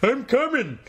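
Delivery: very high, hammed-up cartoon call; Homer Simpson impression. Co-host as Homer coming to the rescue, cartoonish. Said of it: "What if Homer Simpson did it".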